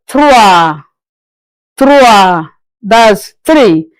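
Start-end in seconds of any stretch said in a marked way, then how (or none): 0.1-2.5 trois said as trwa; French trois. Shown as a demonstration. Teacher drilling trois for beginners. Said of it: "Three is what?".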